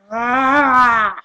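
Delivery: loud, drawn-out exasperated yell; frustrated scream. Acted. Ranter who can't stand it, frustrated scream. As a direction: let out a loud cry of frustration. Frustrated outburst ending the rant.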